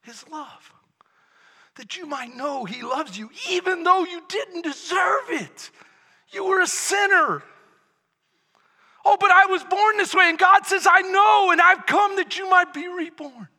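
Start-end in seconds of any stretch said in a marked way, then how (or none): 3.5-13.5 loud, high, impassioned proclamation; full-force preaching. Real, not acted. Preacher tells sinners of rebirth, impassioned full-force preaching. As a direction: cry out the gospel to the crowd.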